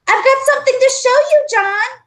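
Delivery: high, loud and fast call across the playground; shouting. A narrator's reading. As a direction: call out eagerly from a distance. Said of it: "she shouted".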